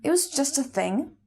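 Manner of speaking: quoting him, as if it were nothing; retold casual dismissal. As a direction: toss it off lightly, lifting at the end. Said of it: "He said he hadn't really thought about it".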